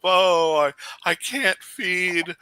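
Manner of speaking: high, whining melodramatic lament; whiny Louis. Retold or imitated. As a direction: whine it out like a brooding vampire. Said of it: "Louis gave us the whiny vampire trope".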